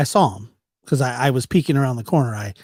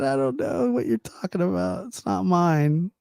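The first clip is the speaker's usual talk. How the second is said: denying friend; 5 semitones higher than usual. Retold or imitated. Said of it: "he said".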